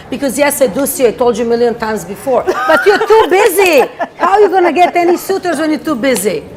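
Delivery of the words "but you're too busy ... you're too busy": higher, louder, exasperated teasing; playful scolding. Real, not acted. Astrologer teases the busy host about finding suitors, playful scolding. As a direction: scold a friend loudly, with amusement.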